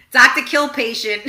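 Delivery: loud laughing read of a joke nickname. Real, not acted. Radio host laughing out loud at a listener's joke name for her. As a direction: crack up reading the comment.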